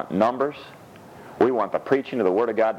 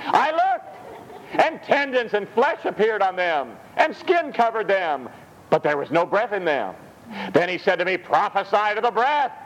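scripture reading; 9 semitones higher than usual. Acted. Reads scripture aloud.

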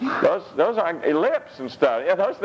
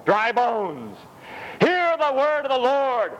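high, ringing emphatic cry, 'Dry bones' lifted; emphatic proclamation; 12 semitones higher, slower than usual. Acted. Preacher shows how Ezekiel must cry out to the dry bones, emphatic. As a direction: proclaim it with an exclamation point. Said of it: "Exclamation points".

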